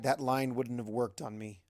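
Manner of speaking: ordinary delivery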